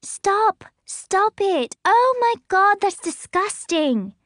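put-on high, loud, disgusted kid yelling; a little girl shrieking at her parents; girl crying out. A narrator's reading. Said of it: "Emmeline cries".

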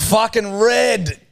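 high, drawn-out shocked outburst; outraged disbelief. Real, not acted. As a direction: stretch it out in disbelief. Outraged verdict.

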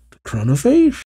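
high, rising incredulous question; lightly acted puzzled query. A narrator's reading. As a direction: lift it sharply into a question. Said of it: "Ziggy questions".

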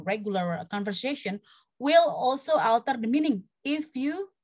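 normal talking voice

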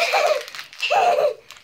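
high burst of laughter; big laugh. Real, not acted. Laughing at the new bed.

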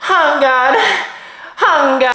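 high-pitched, exaggerated game-character imitation; hammed-up high Jet impression; mocking Jet voice. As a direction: squeal it to mock a Jet voice. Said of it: "in your Jet voice".

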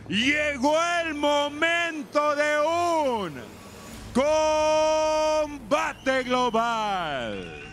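high, very slow drawn-out announcer call; ring announcer. Real, not acted. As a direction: stretch every word like a big fight intro.

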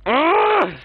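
loud high groan of disgust; frustrated groan. Real, not acted. Frustrated groan after fight.

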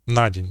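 НА stressed, день unstressed; stress on the preposition. Shown as a demonstration. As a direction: say «На день» as one word, hitting НА. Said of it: "І таких, е, прикладів може бути багато".

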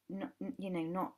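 ordinary talk, nothing marked

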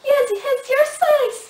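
high, loud, indignant; accusing. Acted. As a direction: throw it back, loud and high. Wife accuses therapist.

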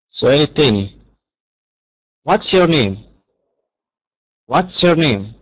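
usual speaking voice

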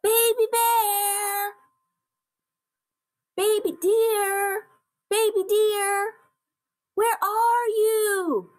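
high, drawn-out calling; searching calls. A narrator's reading. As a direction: call slowly into the distance for a lost little one. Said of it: "the animals cried as they searched".

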